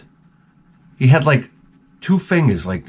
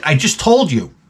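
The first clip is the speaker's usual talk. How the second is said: higher-pitched mock exasperation; exasperated retort; 5 semitones higher than usual. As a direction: act out a baffled comeback. Exasperated retort in retold exchange.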